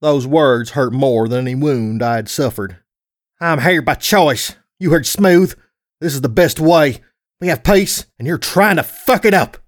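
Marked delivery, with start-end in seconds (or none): 3.4-9.6 raised, heated argument; angry defiance. Acted. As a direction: argue back hotly, pitch climbing. Brothers in a heated quarrel.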